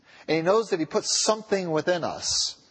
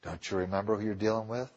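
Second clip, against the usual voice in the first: soft plea; 8 semitones lower, 8 dB quieter than usual. Acted. Preacher closes softly.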